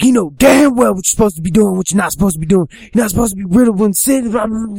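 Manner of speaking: loud, high-pitched angry tirade; acted furious scolding; going off at him. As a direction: rant at the boy without stopping. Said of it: "He's going off at him".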